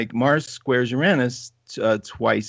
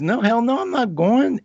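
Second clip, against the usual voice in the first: high mock protest of a scared friend; emphatic refusal; 11 semitones higher than usual. Friend refuses to stay on the ride, high mock protest. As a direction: toss it off high and adamant.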